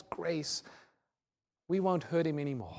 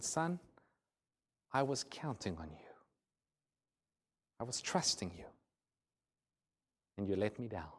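quiet, low, sad father; hurt and hushed, letting the words sink; sad disappointment; 6 semitones lower, 11 dB quieter than usual. Retold or imitated. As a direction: speak softly and heavily, with long pauses between phrases.

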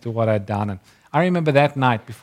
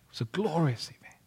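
awed whisper; 3 semitones higher, 9 dB quieter, faster than usual. Real, not acted. Awed memory of the vision.

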